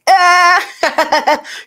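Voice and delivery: high squeal into giggles; exaggerated high creep laugh; mock goofy laugh. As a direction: burst out in a silly put-on cackle.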